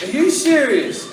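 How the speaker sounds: high, loud, drawn-out disbelief; mock-stunned question, pitch swooping up; incredulous. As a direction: blurt it out in exaggerated disbelief. Doctor questioned back in disbelief.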